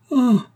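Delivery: high, loud anguished cry; cri déchirant. A narrator's reading. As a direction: cry out as if torn apart. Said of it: "s'écria Antonine avec un cri déchirant". (cri aigu de détresse)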